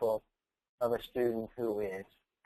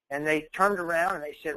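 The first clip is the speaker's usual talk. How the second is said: ordinary delivery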